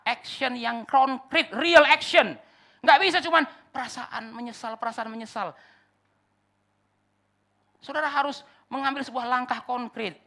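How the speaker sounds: loud, high, slow hammering sermon; fiery pulpit climax; preaching at full force. Acted. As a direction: pound home the call to real action.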